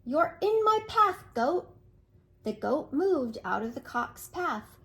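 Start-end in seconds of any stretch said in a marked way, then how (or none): 0.0-1.7 bossy rooster. Acted. Bossy cock orders goat.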